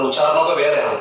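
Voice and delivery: ordinary talk, nothing marked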